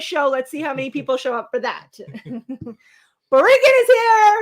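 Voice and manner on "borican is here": high, loud, drawn-out cheer; excited greeting. Real, not acted. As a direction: shout it out for a favourite arrival. Excited greeting to arriving viewer.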